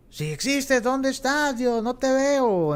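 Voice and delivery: high-pitched mock challenge to God; imitating defiant doubter. Preacher voicing those who disown God, high-pitched challenge. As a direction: call out like a doubter, pitched well up. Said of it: "Muchas personas reniegan de Dios".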